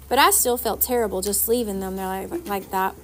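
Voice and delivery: plain reading voice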